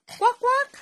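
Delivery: high quack quack; mother showing a toddler the duck sound. Shown as a demonstration. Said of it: "Ano ang sound ka, duck".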